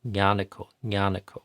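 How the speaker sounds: "Yanako" opening on a palatal nya; nya sound start. Shown as a demonstration. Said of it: "starts with this very sound, this nya sound".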